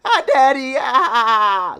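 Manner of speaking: put-on high, loud wailing child voice; child sobbing laugh. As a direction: cry for daddy through laughter. Voicing crying child at execution.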